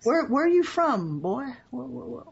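high-pitched, baffled officer voice; high, stammering cop; puzzled police officer. Acted. Officer questions the foreigner.

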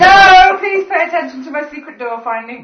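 high shout demanding attention, easing slightly; loud protest. Real, not acted. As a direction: shout over everyone to be heard. Protesting over the others' French antics.

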